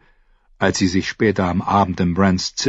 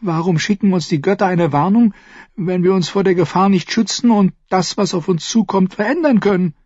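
put-on a girl's voice pitched higher, angry and fast; angry young girl, higher and fast; 12 semitones higher, faster than usual. A narrator's reading. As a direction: sister snapping at her brother in frustration. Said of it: "Daraufhin wurde seine Schwester wütend".

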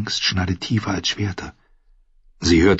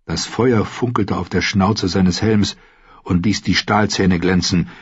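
ordinary delivery, nothing marked

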